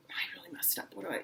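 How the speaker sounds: breathy whisper, barely voiced; whispered inner thought. Shown as a demonstration. Teacher shows the singer's inner monologue, whispered. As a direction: whisper it to yourself in panic. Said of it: "you're like".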